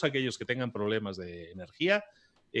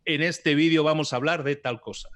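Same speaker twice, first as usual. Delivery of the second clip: upbeat, higher and louder opener; energetic YouTube intro; 5 semitones higher, 6 dB louder than usual. Shown as a demonstration. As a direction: sell the video with energy. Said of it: "si tú estás haciendo un vídeo en YouTube". (presentador entusiasta de YouTube)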